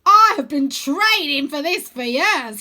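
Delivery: put-on high, slow and loud goofy boy; calling down in a big high cartoon voice; bright playful wizard-boy voice; Wyn's voice. A narrator's reading. Wyn boasts.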